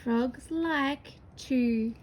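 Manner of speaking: drawn out word by word; stretched sentence for beginners; slow dictation. Shown as a demonstration. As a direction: say it slowly as you write. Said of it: "Let's copy that word onto our paper".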